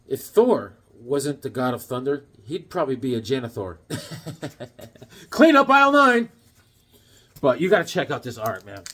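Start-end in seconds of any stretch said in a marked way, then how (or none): 3.8-4.8 quiet staccato chuckle; soft laugh running on. Real, not acted. Laughing at own janitor pun, soft chuckle. 5.3-6.3 loud, high tannoy-style call; mock store announcer. Mock store announcement after janitor joke.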